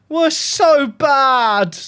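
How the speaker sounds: slow, high wailing lament; anguished wail. Real, not acted. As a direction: moan it out in agony. Lamenting result.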